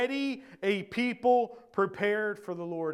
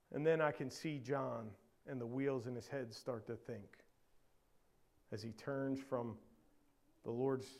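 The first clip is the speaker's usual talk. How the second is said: very low, soft musing; hushed reflection; 9 semitones lower, 15 dB quieter than usual. Real, not acted. Preacher picturing John turning to his own inabilities, hushed. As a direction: sink down quietly, with long pauses.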